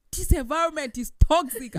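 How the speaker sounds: loud, high-pitched mock outburst; retold outburst. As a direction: exclaim it loudly, re-enacting a confrontation. Retold confrontation with mom, raised voice.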